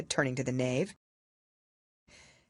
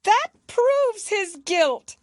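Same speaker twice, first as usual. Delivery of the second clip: much higher, louder, slow imperious queen; shrill queen; 8 dB louder, much slower than usual. A narrator's reading. Queen condemning the knave before the court, imperious. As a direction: bellow it like a tyrant queen.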